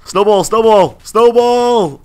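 high, loud repeated calls; gleeful gamer shouting his attack; excited battle call. Real, not acted. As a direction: yell it with every throw. Throwing snowballs at approaching enemies.

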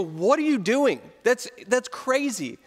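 a friend who thinks she's lost it; incredulous. Retold or imitated. Friends mocking the volunteer as crazy, incredulous.

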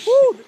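high whooping woo; excited woo. Real, not acted. Whoop at summit snow.

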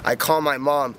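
ordinary talk

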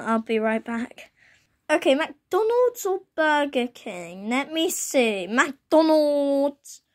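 ordinary talk, nothing marked

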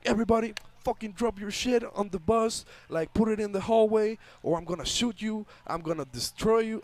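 mildly acted gunman ordering everyone about; gunman's threat. Retelling a gunman robbing the bus passengers, mock threat.

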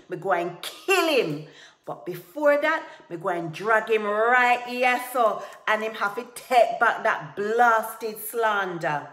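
very loud, raging threat; furious roar. A narrator's reading. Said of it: "Tiger roared".

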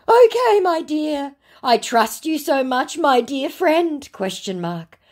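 high, pleading mock scammer. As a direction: ham up the wheedling scammer. Scammer Eric's chat read out.

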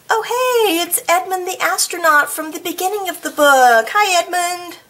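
high, quick delighted hello to Edmund; excited greeting. Real, not acted. Reader spotting Edmund again, excited high greeting.

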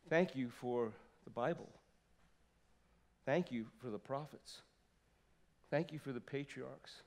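quiet heartfelt gratitude fading to a hush; tender thanks. Pretend. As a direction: thank them gently, almost whispering by the end. Wife's thank-you witnessing.

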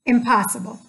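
ordinary delivery

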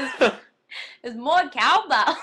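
loud, hammed-up catchphrase impression; botched impersonation, slow and blaring; bad impression. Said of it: "It's so bad".

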